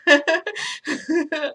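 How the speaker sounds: high, loud acted laugh. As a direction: laugh awkwardly through the word. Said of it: "he starts laughing. This guy's legit laughing".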